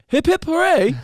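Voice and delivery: high, loud cheer; hooray cheer. Pretend. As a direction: shout it out as a big cheer. Outro cheer, second louder try.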